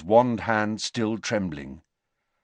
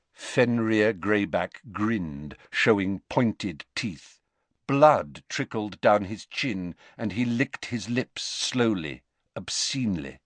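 plain reading voice

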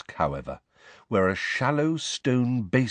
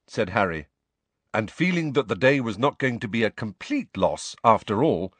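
usual speaking voice

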